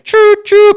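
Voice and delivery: high, loud choo-choo toot; train whistle imitation. Comparing his temper to a steam train.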